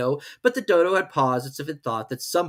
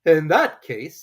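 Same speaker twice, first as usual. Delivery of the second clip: loud solemn opening; solemn Dodo; 4 semitones higher, 8 dB louder, slower than usual. Acted. Dodo rising to speak, solemnly. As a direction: begin solemnly. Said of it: "solemnly rising to his feet".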